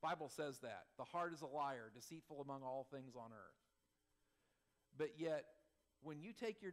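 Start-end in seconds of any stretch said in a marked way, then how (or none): none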